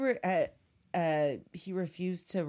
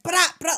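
louder, spat-out gun noise; mock gunfire; 9 semitones higher, 9 dB louder than usual. Retelling the shootout, mock gunshot sounds. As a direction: fire it off like a toy gun.

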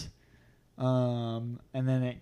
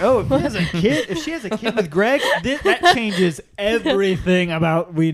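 laughing outrage; 7 semitones higher, 8 dB louder than usual. Retold or imitated. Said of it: "I was like".

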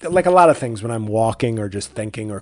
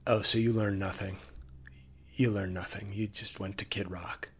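quiet mock reproach; 4 semitones lower, 13 dB quieter than usual.

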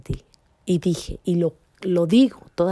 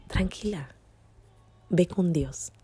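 soft, hushed calming voice; gentle reassurance; 2 semitones higher, 4 dB quieter than usual. Retold or imitated. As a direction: quietly reassure a frightened stranger. Muchacha la tranquiliza tras el susto. (voz suave y tranquilizadora)

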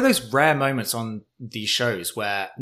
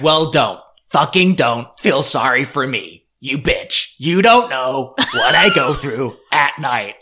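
angry; 6 dB louder, slower than usual. Retold or imitated. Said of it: "Kim's like".

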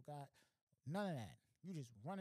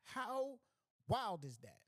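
loud, high amazement; amazed; 7 semitones higher, 9 dB louder, much slower than usual. Real, not acted. Comedian loudly amazed at the deaf robber's story. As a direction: shout your disbelief.

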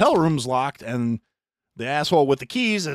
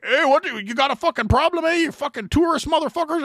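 high, louder aggressive challenge; mock tough guy; 6 dB louder than usual. Hockey players challenging the tourists, loud and aggressive. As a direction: pick a fight, loud. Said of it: "they're like".

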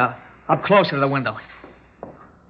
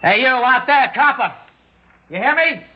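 yelling out; 8 semitones higher than usual. Acted. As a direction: call out to the cop. Gunman yells to cop outside.

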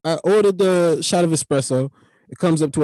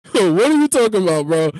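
incredulous reply; 3 semitones higher, 6 dB louder than usual. Retold or imitated. Customer questioning the clerk's advice, loud incredulous outburst. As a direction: burst out, baffled. Acts out his startled reply.

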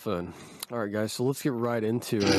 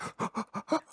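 dramatic gasp; 14 semitones higher than usual. Retold or imitated. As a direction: gasp in mock horror. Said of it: "I was like".

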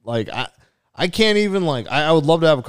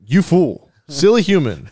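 a louder, higher hammed-up gloating villain; mock villain; 5 semitones higher, 5 dB louder than usual. Taunting the imagined fan, hammed-up villain voice. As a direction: lord it over him like a cartoon overlord. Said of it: "This isn't even my final form".

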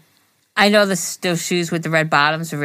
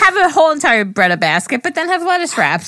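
mock advice; 9 semitones higher, 4 dB louder than usual. Mock diet advice to viewers.

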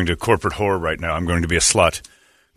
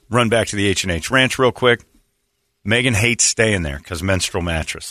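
ordinary talk, nothing marked

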